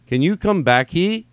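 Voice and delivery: "he" lifted high as a question; retry the line, pushing "he" up in pitch; shifted emphasis. Acted. Comic rereading a typo text to find the emphasis, shifted stress. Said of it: "putting the emphasis on the wrong part".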